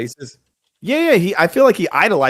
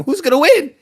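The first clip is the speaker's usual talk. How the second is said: high, louder questioning wife voice; imitated wife; 13 semitones higher, 4 dB louder than usual. Co-host voicing the boxer's wife asking him, high imitated wife. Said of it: "his wife is asking".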